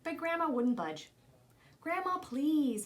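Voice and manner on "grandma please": slow, pleading scold, a little louder; scolding plea. Acted. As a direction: gently scold her own mother, drawn out. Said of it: "Mama scolded".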